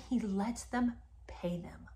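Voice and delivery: whispered and slowed; hushed disbelief at the host paying his bills; scandalized whisper. Real, not acted. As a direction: drop to a shocked whisper. Scandalized aside.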